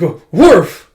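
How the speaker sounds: high, loud woof; imitated eager bark. Bond answering his owner, imitated eager bark. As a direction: bark agreement like a keen dog.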